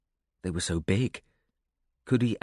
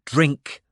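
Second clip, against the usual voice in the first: "Drink" as a forceful, higher-pitched order; ferocious emphasis; 6 semitones higher, 4 dB louder than usual. A narrator's reading. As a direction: order "Drink" with one fierce punch. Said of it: "she said with ferocious emphasis".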